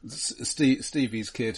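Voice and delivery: ordinary delivery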